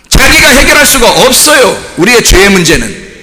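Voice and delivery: high, emphatic preaching voice; forceful preaching. Real, not acted. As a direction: drive each point home to the congregation.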